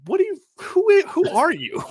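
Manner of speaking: very high, incredulous squawk; comic baffled outburst, way up high; mock bewilderment. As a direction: sputter in exaggerated disbelief. Mock bafflement at wife's accent.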